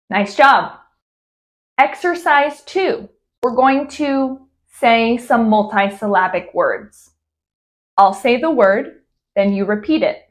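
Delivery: usual speaking voice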